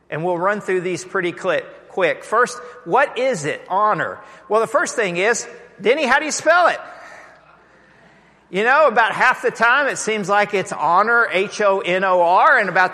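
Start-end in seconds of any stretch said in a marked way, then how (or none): 5.8-6.8 high, louder call across the room. Real, not acted. Pastor calls out to Denny across the room, higher and louder.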